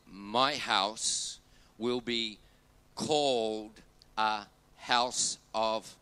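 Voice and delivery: drawn-out words left open for the crowd; slow it right down so they can join in; slow call and response. Shown as a demonstration. Pastor inviting the congregation to finish the verse, slow and open. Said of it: "feel free to finish it with me".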